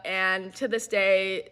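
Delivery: plain reading voice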